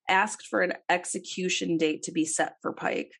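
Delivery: usual speaking voice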